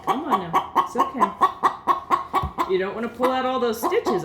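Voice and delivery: calm the struggling bird down, laughing a little at the end; soothing the hen. Real, not acted. Soothing injured chicken during care.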